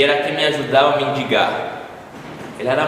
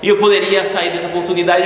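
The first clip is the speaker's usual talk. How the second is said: usual speaking voice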